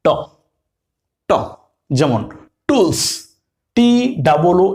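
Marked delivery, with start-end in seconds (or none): 0.0-1.3 ট as a hard retroflex t; Bengali ট sound. Shown as a demonstration. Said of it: "Bangla উচ্চারণের মিল হইল". 2.7-3.0 টুলস with a hard retroflex ট; টুলস pronounced like Bengali ট; English t as ট. Shown as a demonstration. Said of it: "টি এর উচ্চারণ কেমন হয়েছে? ট এর মতো".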